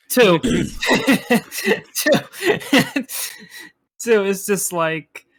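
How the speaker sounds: giggling through the word; laughing out the number. Real, not acted. Laughing repeat of two.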